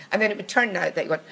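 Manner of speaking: ordinary delivery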